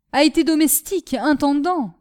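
angry, indignant interjection; violent retort. A narrator's reading. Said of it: "dit violemment Michu".

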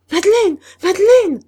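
very high, loud terrified shout; terrified cry. Acted. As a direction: scream her name in terror. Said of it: "s'écria Guillaume épouvanté". (crier son nom, épouvanté)

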